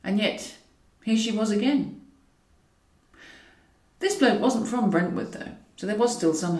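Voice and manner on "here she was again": ordinary delivery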